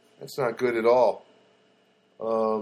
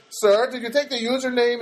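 high mock-polite phone agent; imitated over-polite agent with an edge; edgy fake politeness; 9 semitones higher, 4 dB louder than usual. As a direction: question the caller with strained courtesy. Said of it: "that edge in his voice".

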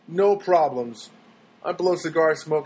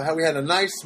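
normal talking voice

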